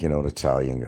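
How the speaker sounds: ordinary talk, nothing marked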